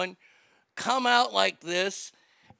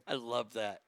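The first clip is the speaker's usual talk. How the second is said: ordinary talk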